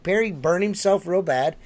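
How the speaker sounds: normal talking voice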